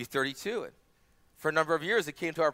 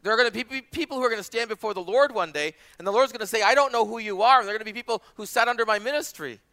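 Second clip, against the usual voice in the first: higher, forceful sermon warning; emphatic preaching; 6 semitones higher, 6 dB louder than usual. Real, not acted. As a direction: press the warning on the congregation.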